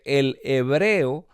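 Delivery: slow demo reading. Shown as a demonstration. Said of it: "Pero si lo lees al revés, dice".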